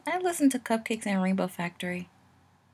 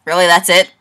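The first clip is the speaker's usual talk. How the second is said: loud; 3 semitones lower, 16 dB louder than usual. Acted. Loud in-character reaction.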